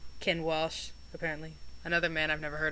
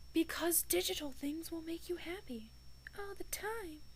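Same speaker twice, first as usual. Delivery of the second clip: sarcastic whisper; 10 semitones higher, 11 dB quieter than usual. Pretend. Sarcastic aside on digital watches.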